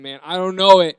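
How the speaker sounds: loud giving-up confession to the interviewer; exasperated admission. Retold or imitated. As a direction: give up and admit it loudly. Finally admits defeat.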